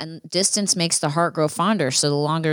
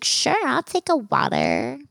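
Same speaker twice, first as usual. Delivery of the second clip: high, slow effeminate drink order; mock effeminate date, high and lingering; 6 semitones higher, slower than usual. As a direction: draw it out in a put-on feminine voice. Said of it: "like, more feminine than I did".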